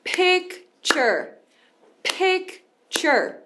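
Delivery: picture spoken slowly to clapped beats; picture, pik then cher, one clap each; two-beat picture. Shown as a demonstration. Teacher clapping out picture's two beats. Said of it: "Bate palma pra você conseguir marcar o tempo".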